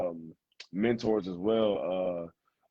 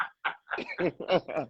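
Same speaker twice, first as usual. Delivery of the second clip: high laugh, mostly breath; 8 semitones higher than usual. Real, not acted. Player amused after boasting about Walterboro, laughing.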